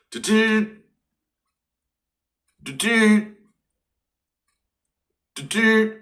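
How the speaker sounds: high, squeaky do-do copier noise; comic high-pitched photocopier sound; machine beeps. Retold or imitated. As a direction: beep like a copy machine. Imitated copying machine noise.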